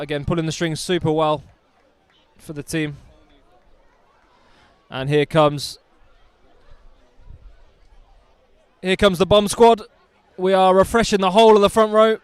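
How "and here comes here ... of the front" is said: hyped announcement. Real, not acted. Commentator announces the replacements.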